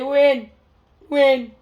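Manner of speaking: loud re-enacted call; punchy acted holler. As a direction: shout the name as if summoning a friend. Re-enacts calling friends in distress.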